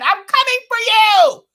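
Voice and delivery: very high, loud imitation of a ranting woman; exaggerated high-pitched call-out; mock yelling threat. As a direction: holler it way up high, hammed-up. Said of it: "when she said".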